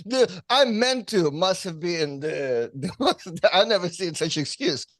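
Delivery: ordinary talk, nothing marked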